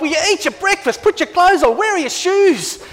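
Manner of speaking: mild mock exasperation hurrying kids; harried parent. Parent nagging kids morning.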